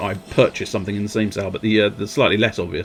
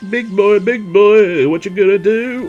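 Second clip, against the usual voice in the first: high, slow sing-song taunting; mock taunt; 9 semitones higher, 5 dB louder than usual. Gamer taunts a big enemy in a high sing-song voice. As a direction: tease the big enemy like a toddler.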